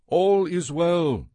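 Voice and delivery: drawn-out call to the king, pitched high; crying out. A narrator's reading. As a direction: call it out slowly from a distance. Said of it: "Ahimaaz cried out to the king".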